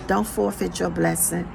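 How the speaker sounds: ordinary talk, nothing marked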